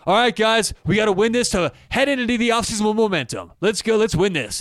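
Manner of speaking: a mock coach's pregame rally cry; Ryan Day pep talk. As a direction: play a coach firing up the locker room. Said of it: "I guarantee you Ryan Day isn't saying".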